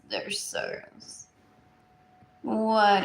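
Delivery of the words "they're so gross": slow disgusted whisper. Real, not acted. Repeats gross line, then whispers.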